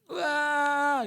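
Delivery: high, drawn-out "waaah" yell; sudden high mock scream; demonstrated yell. Shown as a demonstration. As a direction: let out a long wordless scream as a demo. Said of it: "Suka teriak".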